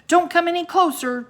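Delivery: high, loud warning; yell. A narrator's reading. Jack warns the sea serpent off, loud high yell. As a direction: yell to keep the monster back. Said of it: "Jack yelled".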